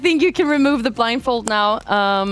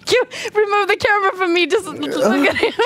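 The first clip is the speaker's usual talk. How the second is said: laughing; 9 semitones higher than usual. Real, not acted. Host laughing at guest.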